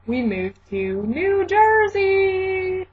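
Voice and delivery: high, drawn-out fanfare; drawn-out announcement. Real, not acted. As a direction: sing out the big news, dragging the last word. Announces the big move.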